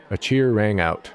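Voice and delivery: ordinary delivery, nothing marked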